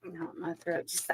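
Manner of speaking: throat tight, nearly unvoiced; holding back tears, softly; choked up. Real, not acted. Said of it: "I'm about to cry".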